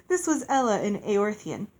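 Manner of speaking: usual speaking voice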